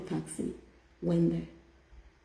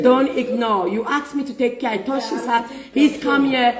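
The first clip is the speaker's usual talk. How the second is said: inner voice scolding; 5 semitones higher, 6 dB louder than usual. Retold or imitated.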